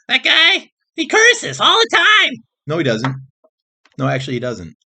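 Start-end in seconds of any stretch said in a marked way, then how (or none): 0.1-2.3 high, loud, slow whiny put-on voice; mock complaining viewer. Vlogger mocking a viewer who hates cursing, high whiny squeal. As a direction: squeal it like a prissy tattletale.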